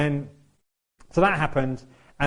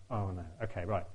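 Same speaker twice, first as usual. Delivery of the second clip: self-talk; 4 semitones lower, 7 dB quieter than usual. Retold or imitated. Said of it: "so I'm like".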